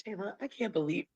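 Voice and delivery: ordinary talk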